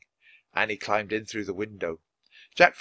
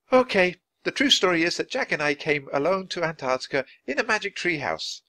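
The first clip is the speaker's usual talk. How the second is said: Annie, high and eager, blurting the truth; a young girl spilling a secret, higher; 7 semitones higher than usual. A narrator's reading. Annie confessing to Nancy, high and eager.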